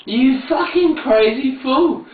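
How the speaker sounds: high, slow teasing scold; mock scold. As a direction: tell him off playfully, drawn out.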